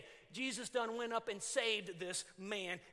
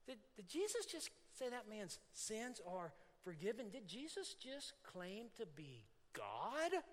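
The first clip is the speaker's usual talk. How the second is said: hushed disbelief; 7 dB quieter than usual. Retold or imitated. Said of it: "people are like".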